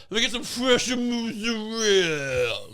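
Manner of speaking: hammed-up Italian deli order, slowed; over-the-top Italian. Shown as a demonstration. How you shouldn't order cheese, in a slow mock Italian accent. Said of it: "you don't go".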